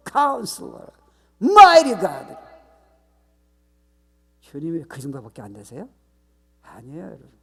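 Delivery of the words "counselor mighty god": high, ringing call that builds to a shout; grand proclamation. Real, not acted. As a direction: proclaim each title, the last one loudest. Proclaims God's titles in sermon. (높고 크게 외치는 선포)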